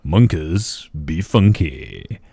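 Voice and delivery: big, slow, loud shout-out; funky announcer. Pretend. Host thanking a new subscriber, funky announcer. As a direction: draw the name out loudly like a funk DJ.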